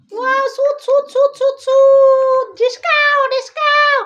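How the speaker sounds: high, excited shooting sounds, loud on one; playful gun noises. Pretend. Mother plays shooting game, shouting high mock gunshot sounds. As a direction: fire pretend shots like a kid.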